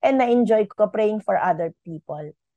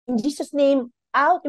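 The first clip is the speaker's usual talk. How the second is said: mock a forceful deliverance prayer; forceful rebuke; 5 semitones higher than usual. Shown as a demonstration. Example of dramatic healing prayer she calls unneeded, commanding shout. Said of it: "yung mga ganun".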